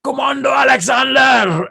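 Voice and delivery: high-pitched drill shout of a name; instructor's shout. Acted. Instructor springing a test on trainee crossing a rope, shout. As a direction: call out the trainee as if across a river. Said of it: "the instructor will shout".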